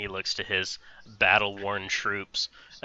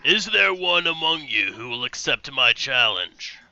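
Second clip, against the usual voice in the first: beast's challenge; 4 semitones higher than usual. Acted. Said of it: "he goes".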